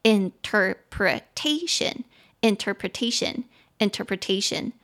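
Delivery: "interpretation" said slowly, stress on the fourth syllable te; 慢读 "interpretation", 重音放在第四个音节 te 上. Shown as a demonstration. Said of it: "重音节在te". (重音落在 te, 慢速重复 "interpretation")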